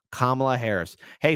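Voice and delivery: ordinary talk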